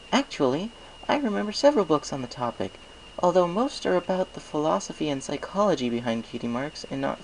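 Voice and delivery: curious scholar warming to a topic; Twilight's voice. Acted. Twilight speaks in story.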